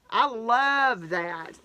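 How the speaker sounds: slow, drawn-out I love that; heartfelt delight. Real, not acted. Thanking her friend for the bag, slow and heartfelt. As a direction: savour each word of the thanks.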